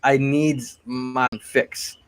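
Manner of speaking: ordinary talk, nothing marked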